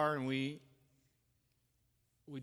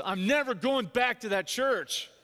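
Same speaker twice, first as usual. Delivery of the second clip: imitating an upset dad, high and loud; indignant refusal; 6 semitones higher, 5 dB louder than usual. Dad on the phone to his child after church, indignant. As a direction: put your foot down, emphatically.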